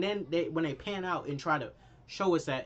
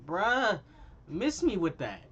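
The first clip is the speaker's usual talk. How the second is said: dismissive bruh; 4 semitones higher, slower than usual. Real, not acted. Breaks off reading to reject.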